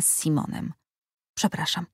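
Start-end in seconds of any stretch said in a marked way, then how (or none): 1.4-1.9 softer, barely breathed sorry; a hushed sorry before fleeing; whispered apology. Acted. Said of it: "szepnęła". (cichutkie przepraszam, prawie szeptem)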